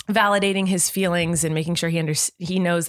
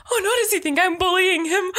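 high-pitched worried parent; mock dismay; 13 semitones higher than usual. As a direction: fret in a much higher voice, half laughing. Acts out her dismay at toddler's hurt.

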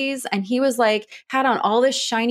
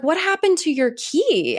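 concerned question; 7 semitones higher than usual. Retold or imitated. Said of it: "and he's like".

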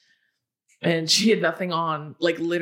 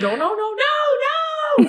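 panicked shouting; 5 dB louder, slower than usual. Retold or imitated. Guard panicking, retold again.

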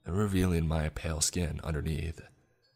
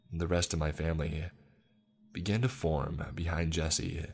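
ordinary delivery

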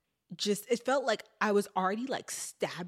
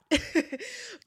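short laugh; 3 dB louder than usual. Real, not acted. Laughs at lawnmower noise.

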